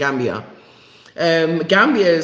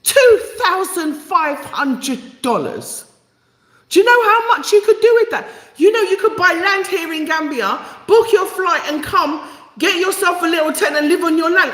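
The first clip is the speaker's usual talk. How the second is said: much higher, incredulous outburst with bursts of laughter; livid rant; 11 semitones higher than usual. Real, not acted. Vlogger ranting to viewers about visa fees, livid and laughing. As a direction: rant in disbelief at the cost, half laughing. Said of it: "I'm absolutely livid".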